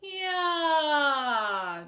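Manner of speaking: big open sigh sliding down; loud yawning sigh. Shown as a demonstration. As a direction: let out a full yawn sound. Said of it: "Just make a couple yaws".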